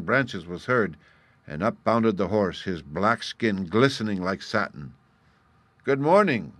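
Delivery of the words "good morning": higher, louder passing greeting; called out. A narrator's reading. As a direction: call it out as you rush by. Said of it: "he called to the boy".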